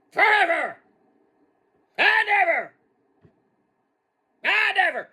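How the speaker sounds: very high, loud put-on voice; hollering character. Character chimes in after the Japan boast, high hollered catchphrase. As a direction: holler each phrase high and long.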